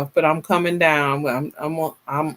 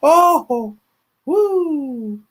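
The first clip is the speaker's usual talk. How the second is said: high, amused whoop; 10 semitones higher than usual. Real, not acted. Host tickled by her own title, high woo.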